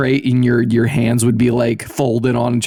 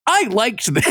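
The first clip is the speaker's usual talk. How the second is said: mock TV chef; 9 semitones higher than usual. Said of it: "being like".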